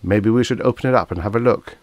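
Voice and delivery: ordinary delivery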